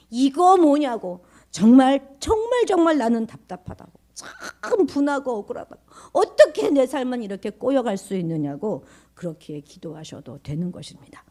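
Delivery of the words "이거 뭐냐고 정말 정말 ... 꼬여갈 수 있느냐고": aggrieved prayer, raised pitch and volume; frustrated outcry. Pretend. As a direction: pour out the grievance to God. Honest complaint prayer voiced out.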